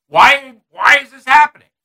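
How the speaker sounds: indignant, pleading why; imitating frustrated Trump. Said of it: "He said".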